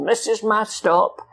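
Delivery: high, drawn-out voice for a driver; mimicked driver. Driver tells hitchhikers they've reached the stop, high drawn-out mimicry.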